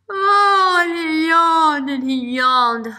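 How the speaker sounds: loud, high drawn-out yawn through the words; big yawn. A narrator's reading. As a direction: yawn the words out like a sleepy bunny. Narrator acts the bunny's yawn.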